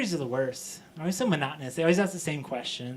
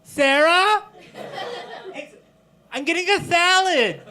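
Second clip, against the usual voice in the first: loud, high-pitched announcement; calling out; 10 semitones higher, 5 dB louder than usual. Acted. Boss making sure Sarah hears about lunch, calling loudly. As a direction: holler it high across to a coworker.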